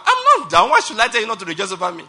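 loud, high-pitched, rapid objection; indignant protest. Acted. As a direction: protest loudly, pitch leaping up on "not down". Acting out someone standing, protesting indignantly.

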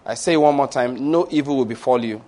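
measured, rolling pronouncement of blessing; preaching blessing. Acted. Pastor pronounces blessings over the congregation, measured and rolling. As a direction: bless the flock with steady authority.

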